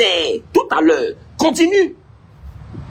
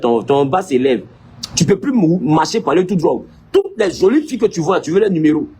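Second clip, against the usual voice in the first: ordinary delivery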